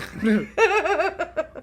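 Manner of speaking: light laugh; short amused laugh. Real, not acted. Laugh after sarcastic remark.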